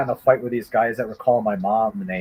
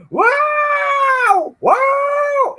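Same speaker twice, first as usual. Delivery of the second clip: mock high scream, not very loud; retold scream; 3 dB louder than usual. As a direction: imitate a distant screaming kid. Kid screaming after stab.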